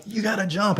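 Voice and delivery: urgent plea. Retold or imitated. Urging the kid to jump.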